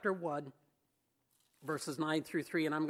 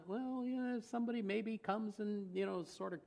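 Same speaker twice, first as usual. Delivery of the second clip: softer, mock lazy shrug of a voice; mock half-hearted; 2 semitones higher, 8 dB quieter than usual. Preacher mimics passive churchgoers, soft mock half-hearted. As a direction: imitate a noncommittal shrug.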